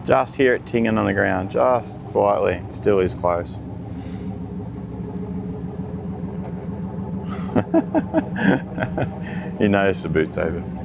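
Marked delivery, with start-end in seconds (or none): 3.6-9.5 long high near-silent laugh; drawn-out high laugh, barely voiced. Real, not acted. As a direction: laugh under your breath at the mishap. Laughing at the boot being open.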